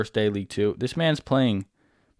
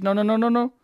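high-pitched rapid protest; real frantic denial; panicked noes; 13 semitones higher than usual. Real, not acted. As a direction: plead with the game in panic. His unit about to die.

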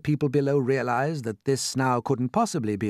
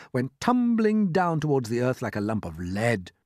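ordinary delivery, nothing marked

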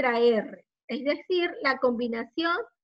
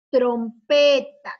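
slow, loud TROMPETA; drawn-out model word; 8 dB louder, much slower than usual. Shown as a demonstration. Teacher presenting a word with tro to pupils, slow and loud. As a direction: announce "Trompeta" loudly, stretching its syllables.

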